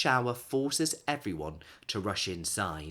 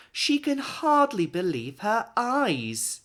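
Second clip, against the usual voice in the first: higher, louder and slow with wonder; amazed; 11 semitones higher, 6 dB louder, slower than usual. A narrator's reading. Narrator sharing the old lady's amazement, high and slow. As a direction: marvel at it slowly.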